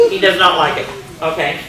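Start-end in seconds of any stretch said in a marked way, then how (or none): none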